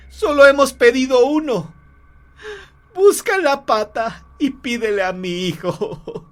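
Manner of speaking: high, loud, frantic pleading; desperate demand. Acted. Mother orders husband to wish for son, desperate.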